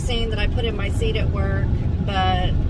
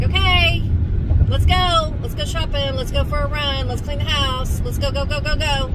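mock pep talk; 5 semitones higher than usual. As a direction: rev yourself up to get moving. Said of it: "I'm like".

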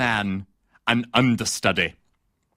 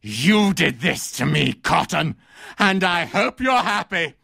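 bitter parting shot, climbing in pitch; melodramatic accusation; 4 semitones higher than usual. Acted. Critic blaming the actor for his ruin, wounded melodrama.

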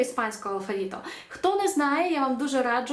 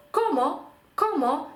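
«комо» modelled as KO-mo, pitched up; penultimate stress; 6 semitones higher, slower than usual. Shown as a demonstration. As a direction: stress the first syllable of «комо». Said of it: "читаються, е, не за правилами".

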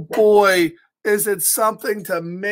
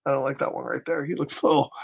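ordinary delivery

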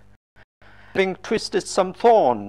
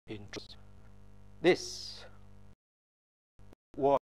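much softer, nearly whispered; awed hush; 5 semitones lower, 15 dB quieter than usual. A narrator's reading. Terrified centurion realizing the truth, hushed awe. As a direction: breathe it out in awe. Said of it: "they were terrified and said".

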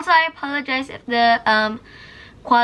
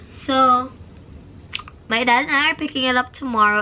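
normal talking voice

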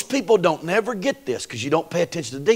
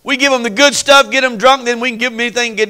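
raised mocking tone; 6 semitones higher, 4 dB louder, faster than usual. Preacher mocking hosts, high.

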